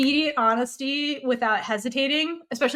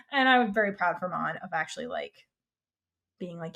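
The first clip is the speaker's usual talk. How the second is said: usual speaking voice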